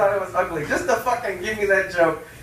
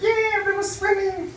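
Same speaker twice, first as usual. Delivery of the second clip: put-on high, small four-year-old voice; little kid voice; 13 semitones higher than usual. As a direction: play the eager little boy. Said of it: "I was like".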